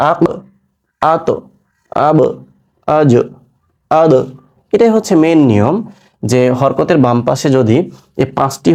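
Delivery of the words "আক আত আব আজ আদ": each syllable ends in a bouncing stop; qalqala drill. Shown as a demonstration. Teacher running the drill with the class. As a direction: drop each final consonant with a small echo. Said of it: "তাহলে কি হলো প্রথমে".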